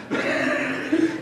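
breathless burst of laughter; laughing. Real, not acted. Pastor cracking up at his own fishing story. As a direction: laugh at the funny fish story.

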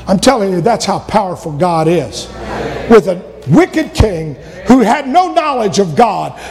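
ordinary talk, nothing marked

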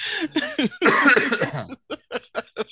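laughing cough; choking cough mid-laugh; coughing fit. Real, not acted. Coughing after vaping.

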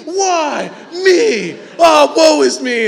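high, loud whiny wail of woe; mock self-pity. A spoiled kid whining to a parent, loud and high.

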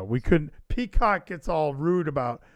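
normal talking voice

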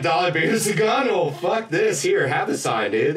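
high, loud mock outrage from the bar staff; squeaky mock outrage at the students. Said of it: "They're like".

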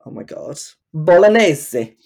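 slow, exaggerated Italian Bolognese; mock Italian accent. Mocking Dorit's accent, slow hammed Italian Bolognese. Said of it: "her accents".

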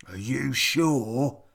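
doubtful troll. A narrator's reading. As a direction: ask it like a wary troll. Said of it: "said the troll".

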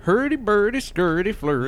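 high bouncy mock-Swedish gibberish. Showing how Swedish sounds to American ears, mock-Swedish lilt. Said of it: "Swedish Chef does just kinda sound like".

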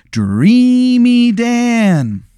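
slow, drawn-out, high sinister clown greeting; creepy clown voice. Acted. Giant clown greets Sarah, slow and creepily high. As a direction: purr it like a menacing giant clown.

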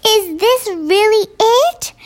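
high, awed giant voice; the kind giant asks in wonder; gentle giant voice. A narrator's reading. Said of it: "the big friendly giant".

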